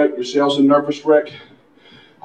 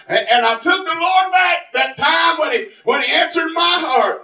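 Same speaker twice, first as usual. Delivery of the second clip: higher-pitched revival preacher; impassioned pulpit testimony; fervent preaching; 13 semitones higher than usual. Real, not acted. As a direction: testify with rising fervor. Preaches at full force.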